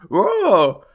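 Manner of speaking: high, loud sudden whoa; a sudden high yelp of surprise; startled whoa. Real, not acted. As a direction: yelp it out, caught off guard. Reacting to her own mock quote.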